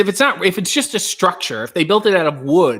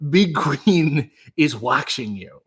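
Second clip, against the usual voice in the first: slow, lower mock warning; mock ominous; 4 semitones lower, slower than usual. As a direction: draw it out like a playful Big Brother warning. Ominous Big Brother-style warning.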